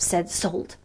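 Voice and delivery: ordinary delivery, nothing marked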